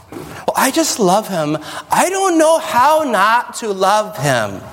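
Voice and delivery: slow, dreamy lovesick imitation; slow dreamy mock excuse; mock lovesick sigh. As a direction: sigh it like a helpless crush.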